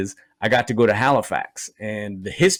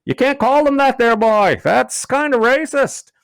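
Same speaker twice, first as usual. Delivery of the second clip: put-on drunk Newfie; 12 semitones higher, 5 dB louder than usual. Drunk Newfie leaning in to scold the comedian, high Newfoundland accent. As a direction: scold him like a drunk Newfie, dead serious. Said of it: "he leans in on me and goes".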